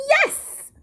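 high, loud, eager yes. A narrator's reading. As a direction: pipe up high and emphatic. Meadow imagines eager thanks.

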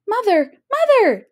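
high, loud urgent call. A narrator's reading. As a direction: yell for mother. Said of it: "Jack called".

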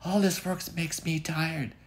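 put-on very high sleepy girlish voice; a yawning little girl; tired Goldie. Goldie ready for bed, high tired girl voice. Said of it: "that golden girl said".

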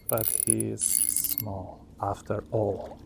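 low, slow, quiet fisherman drawl; slow it right down, low and soft; Catfish Dave voice. Retold or imitated. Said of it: "Talking like Catfish Dave now".

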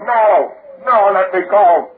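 high, loud cries, breaking into a scream; terrified pleading. Acted. Mark Street begs his killer to let him go, screaming. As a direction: beg for your life. Struggling, crying out in terror.

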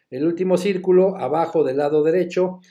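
usual speaking voice

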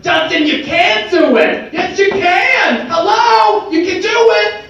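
high, loud, drawn-out mock cheerleader; weird Bronx voice. Inner voice now encouraging Justin, high comic Bronx cheer. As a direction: cheer in a silly high-pitched Bronx accent. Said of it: "turn that weird voice, that Bronx accent".

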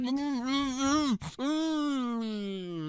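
soft whimpering hums through a gag; mock muffled crying. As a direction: pretend to be gagged and crying. Kid kidnapped, gagged.

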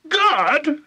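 very high, outraged screech; screaming. A narrator's reading. Grandma disputing the medicine's good, screaming. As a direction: scream it back in disbelief. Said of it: "she screamed".

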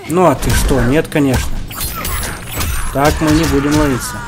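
normal talking voice

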